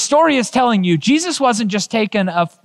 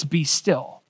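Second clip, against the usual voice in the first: soft command; 4 semitones lower, 7 dB quieter than usual. Retold or imitated. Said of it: "can command to the waves".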